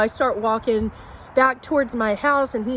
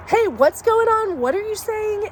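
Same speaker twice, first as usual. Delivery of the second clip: much higher, calling across the street; a sudden high hail to the stranger; 9 semitones higher than usual. Retold or imitated. Brandon walking up to the angry man, calling out.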